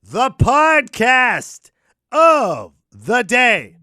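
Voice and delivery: very slow, high and loud, each word stretched; announcer segment title. Acted. Host to listeners, announcing the podcast of the day in a slow, loud, stretched announcer voice. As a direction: announce it like a big show segment.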